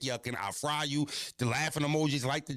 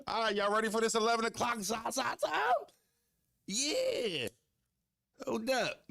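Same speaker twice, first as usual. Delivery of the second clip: hype call; 12 semitones higher than usual. Real, not acted. Hyped announcement to chat.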